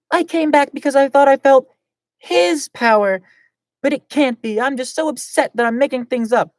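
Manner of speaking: high, loud upset voice. Acted. [name] doubting what she felt, high and upset. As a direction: say it high and shaken.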